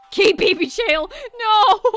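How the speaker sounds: high, loud, drawn-out protest; mock anguished shout. Streamer protesting the crab being caged, mock anguished shout. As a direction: wail it loudly in hammed-up dismay.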